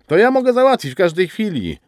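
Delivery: high, quick and coquettish; flirtatious. A narrator's reading. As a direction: tease him with a wink in your voice. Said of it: "mrugnęła do niego zalotnie".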